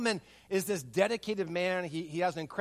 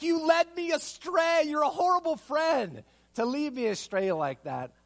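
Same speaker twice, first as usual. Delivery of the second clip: mock accusation; 9 semitones higher, 3 dB louder than usual.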